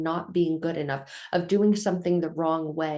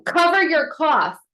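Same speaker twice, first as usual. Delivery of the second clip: imitating a stranger's loud, high rebuke; mock scolding shout, pitched well up; 10 semitones higher, 8 dB louder than usual. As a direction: call it out loud and high, telling someone off. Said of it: "the volume in and of itself caught my attention".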